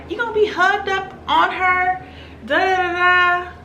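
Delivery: high-pitched mock confrontation; ratchet girl. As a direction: imitate a ratchet girl picking a fight, high-voiced.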